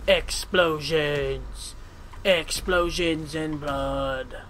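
very slow, stretched vowels; drawn-out savouring. Pretend. Player relishing game carnage, slow drawn-out delivery. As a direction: linger on every word.

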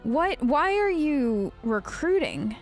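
ordinary talk, nothing marked

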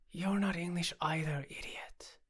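exasperated, softening into a sigh; sighed retort. A narrator's reading. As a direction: sigh it out, dropping to breath on the last word. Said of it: "I sighed".

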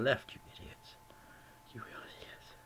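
unvoiced, hushed jab at the fans; whispered insult. Real, not acted. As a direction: mutter the insult under your breath.

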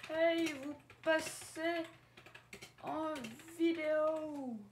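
high, slow sing-song hey to viewers; call-out greeting. Acted. Youtuber hailing viewers in a high drawn-out call. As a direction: stretch it out like a big hello.

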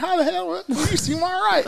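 his head exploding with surprise, squeaked way up; shitty Caleb Copeland, shocked. Retold or imitated. Caleb hearing the cook is from New York, extremely high mock shock. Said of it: "I did such a shitty Caleb Copeland".

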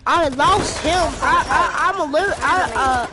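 high, loud and flustered; excited. Real, not acted. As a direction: stammer out the callout in a rush. Frantic gameplay callout.

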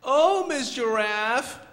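high, loud little-bird voice; slow, pleading baby bird; Choco. Acted. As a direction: cry out high and drawn-out as a lonely chick. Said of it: "How about you be the voice of Choco?".

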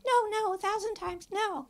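high, melodramatic squeal; hammed-up Scarlett protest; mock protesting heroine. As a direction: ham up a swooning refusal. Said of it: "she's going".

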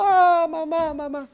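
high, hammed-up childish wail for mom; a little boy crying for his mother after a fall, mock wail. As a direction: wail like a small hurt boy. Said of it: "Y llorando".

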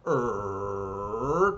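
low, drawn-out rumbling rrr; tank engine noise. Acted. Narrator sounds out the tank moving off, imitated engine. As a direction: growl like a motor starting up.

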